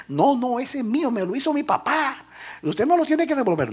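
put-on a small boy demanding his boat back; child insisting. Boy arguing with the shopkeeper, high pleading child voice.

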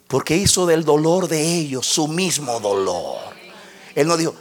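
usual speaking voice

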